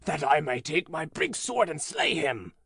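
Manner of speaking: a hint of vengeful anger; giant's anger. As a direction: giant demanding to know who hurt the child. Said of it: "cried the giant".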